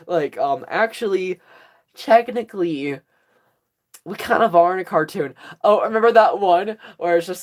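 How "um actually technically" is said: ordinary talk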